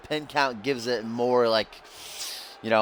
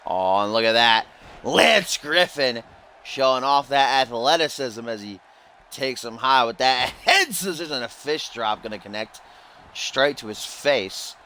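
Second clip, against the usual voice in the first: lively wrestling commentator call; play-by-play commentary; 2 semitones higher than usual. Acted. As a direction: call the match like a ringside announcer.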